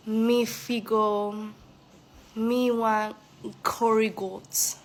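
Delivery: slow, drawn-out Jamaican patois; Jamaican accent. Shown as a demonstration. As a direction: put on a Jamaican accent, savouring each word slowly. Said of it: "let me say a few words, and if you're Jamaican".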